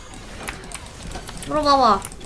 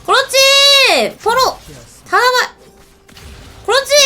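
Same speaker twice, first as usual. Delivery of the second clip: excited cheer; 8 semitones higher than usual. Real, not acted. Cheering on a game fight.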